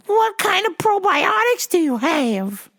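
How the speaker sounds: high slow mock old lady demand; demanding old caller. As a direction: drag it out like a stubborn caller. Caller asks.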